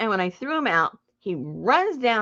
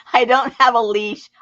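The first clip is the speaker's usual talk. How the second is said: normal talking voice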